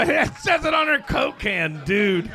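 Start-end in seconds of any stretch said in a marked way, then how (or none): none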